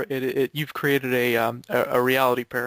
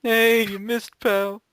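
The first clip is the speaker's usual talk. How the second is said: put-on high, cranky old ape voice; Cranky Kong impression; 8 semitones higher, 4 dB louder, much slower than usual. Friend mocks a missed jump as grumpy Cranky. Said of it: "for Cranky to say every time we screw up".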